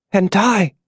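high, feeble question; weak dying voice. A narrator's reading. Dying June asks about her son, weak high voice. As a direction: ask with failing strength. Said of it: "she mouthed between wet breaths".

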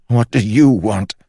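high, loud desperate demand. Acted. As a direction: shout the question at it. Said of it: "asked Holland".

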